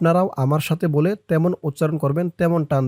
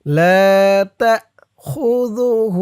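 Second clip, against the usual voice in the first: slow, higher chanted recitation with long vowels; Quranic recitation for repetition; 5 semitones higher, 3 dB louder, much slower than usual. Shown as a demonstration. Teacher leads students to recite together. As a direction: chant it slowly so they can follow. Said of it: "আমার সাথে বলুন".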